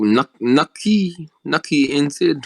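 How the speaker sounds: ordinary delivery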